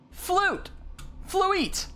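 high, triumphant cry; sudden excited high guess; eureka shout. Real, not acted. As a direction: blurt out the answer you just cracked. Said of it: "F L U I T E".

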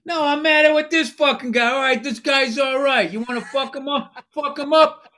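high, loud, hammed-up street aggressor; shirtless neighborhood tough. Shirtless guys squaring up over a wife, mock aggressive shouting. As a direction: pick a fight in a put-on thug voice. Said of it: "Shirtless guys with gold shark tooths".

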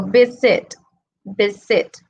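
"visit" said with the weight on VI; stress on first syllable. Shown as a demonstration. Teacher showing the strong first syllable, "visit" stressed on VI. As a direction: hit the opening syllable of "visit", let the end fall. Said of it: "lo fuerte está en B".

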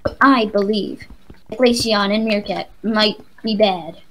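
slow, deliberate prologue voice; mock intro narration. Gamer finishing a parody intro for a friend, slow narration. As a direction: draw it out like a grand show prologue.

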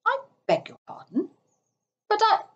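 high-pitched polite disbelief; incredulous, halting interruption; taken aback. A narrator's reading. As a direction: query it as if you misheard. Rat startled by Toad's plan.

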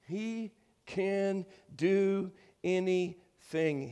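each word stretched out, high; slow emphatic proclamation. Real, not acted. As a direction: space out every word for the congregation. Preacher drives the point home.

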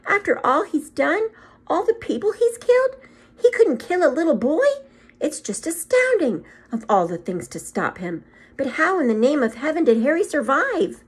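can't take it in; astonished witch. Acted.

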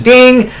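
very high mock buzzer ding. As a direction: chirp a shrill quiz buzz. Nerd buzzing in again.

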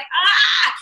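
short high mock scream of frustration; frustrated yell. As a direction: let out a quick exasperated shout. Frustrated by hometown comments.

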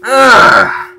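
loud, high exasperated groan; frustrated groan. Real, not acted. Frustrated after dying.

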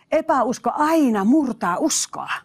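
normal talking voice

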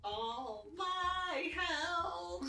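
very slow mock-dramatic moan; drawn-out lament. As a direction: drag the words out mournfully.

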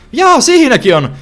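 high, loud, slow jaa of surprise; surprised yelp. Real, not acted. Player spots another fragment, surprised yelp. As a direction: yelp at the sudden find.

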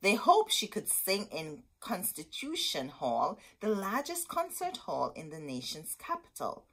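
ordinary delivery

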